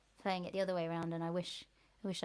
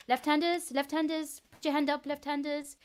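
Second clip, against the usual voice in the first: put-on higher-pitched teacher in a muddled Irish-French accent; weird hybrid Irish-French accent; 7 semitones higher than usual. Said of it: "some kind of weird hybrid accent".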